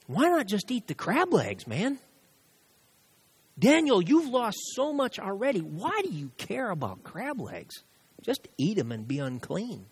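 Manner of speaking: preacher voicing Satan tempting Daniel to eat. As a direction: taunt Daniel like a sly tempter. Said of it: "hear Satan talking like that to you".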